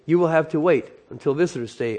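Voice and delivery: plain reading voice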